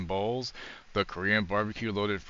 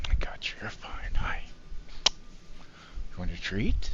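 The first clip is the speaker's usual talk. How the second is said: talking to a dog; much slower than usual. Real, not acted. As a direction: hush and soothe the dog. Soothing the puppy, offering treat.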